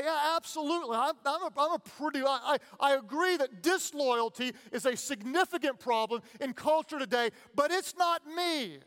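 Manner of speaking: high, breezy voice of smug denial; mock self-assured voice. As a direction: parody the person who thinks it's not them. Mocking typical self-assessment.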